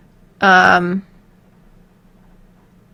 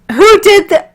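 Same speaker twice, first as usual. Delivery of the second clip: high, loud mock outrage; startled who did that; 11 semitones higher, 7 dB louder than usual. Opening an old voicebank, she yelps at her own work in mock surprise. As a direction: yelp it in fake shock. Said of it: "I'm like".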